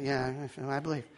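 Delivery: low, soft half-hearted assent; unenthusiastic. Retold or imitated. Congregation member reluctantly answering the preacher, half-hearted and soft. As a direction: mumble it low, with no enthusiasm. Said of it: "you wouldn't be that enthusiastic".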